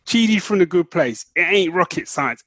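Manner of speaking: usual speaking voice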